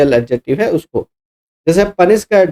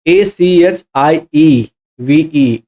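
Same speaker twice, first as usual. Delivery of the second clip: ए सी एच आई ई वी ई, slow and letter by letter; spelled out slowly; much slower than usual. Shown as a demonstration. Teacher showing students the spelling of achieve, ए सी एच आई ई वी ई slowly spelled out. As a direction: spell ए सी एच आई ई वी ई with gaps between letters. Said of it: "achieve की ये spelling होती है".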